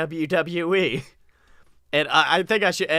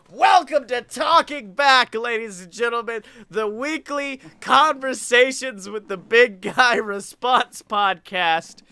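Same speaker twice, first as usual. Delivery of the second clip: mock announcer; 5 semitones higher, slower than usual. Opening the podcast, hammed-up announcer voice. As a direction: boom it like a show host.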